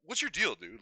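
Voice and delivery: a hammed-up what's your deal; mock exasperated. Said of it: "I'm like".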